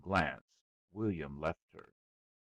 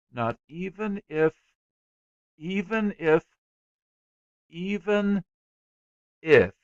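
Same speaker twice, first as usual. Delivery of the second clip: "Not even if, even if, even if," broken by long pauses, rising higher and louder; halting pauses; 11 semitones higher, 14 dB louder than usual. A narrator's reading. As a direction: stall on each "even if" as if searching for the worst case. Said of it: "he paused to consider".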